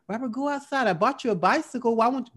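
high-pitched mother's voice urging; mom's voice. Retold or imitated. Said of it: "I can hear my mom's voice now".

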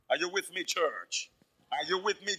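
normal talking voice

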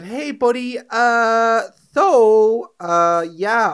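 high, loud, drawn-out hesitation; hammed-up reluctance, stretching every filler; awkward stalling. Worker texts manager about roster, hammed-up awkwardness.

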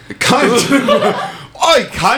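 high laughing Cockney-style "oi"; mock British accent. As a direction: shout it like a cheeky Brit.

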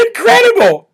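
high-pitched squeak after laughing; real giggly exclamation; laughing disbelief. Real, not acted. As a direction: say it helpless with amusement. Still laughing after the impression.

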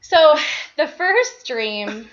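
breathless, halting phrases; shallow breathing. Real, not acted. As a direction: still catching her breath. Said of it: "Sorry, I'm out of breath".

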